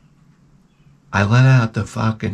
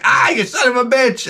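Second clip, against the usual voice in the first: yelp of pain; 11 semitones higher than usual. Retold or imitated. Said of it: "And I'm like".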